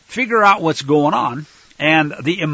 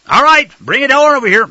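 loud, higher-pitched summons; acted call; 8 semitones higher, 7 dB louder, faster than usual. Father telling his son to bring food, loud call. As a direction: call out for the dish to be brought.

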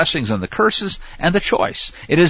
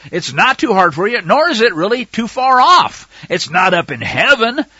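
raised, emphatic reading voice; scripture reading; 8 semitones higher, 5 dB louder than usual. Acted. Preacher reading Deuteronomy aloud, emphatic. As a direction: proclaim the verse to the flock.

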